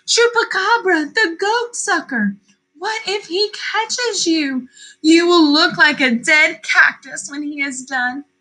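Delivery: high, worried mother warning. A narrator's reading. Mother warning her sons about Chupacabra, anxious. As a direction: warn them of the danger. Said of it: "their mother pleaded".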